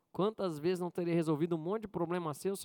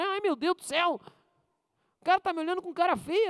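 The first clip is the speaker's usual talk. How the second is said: high, hammed-up worried inner voice; mock fright; 9 semitones higher, 3 dB louder than usual. Preacher mimicking a nervous believer, mock-frightened and high. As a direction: play a scared churchgoer, half joking. Imagining fear of an angry face.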